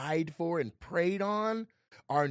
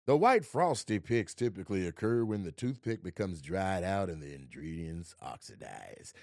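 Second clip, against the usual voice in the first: show narrator voice; 6 semitones lower than usual. Retold or imitated. Actor clowning at rehearsal as the show's narrator. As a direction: settle into a measured documentary-style read. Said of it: "the show has a narrator".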